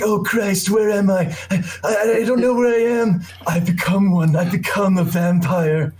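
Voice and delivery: hammed-up high, anguished wail of a man losing his mind; Nicolas Cage impression. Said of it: "he talks in this weird accent throughout the movie".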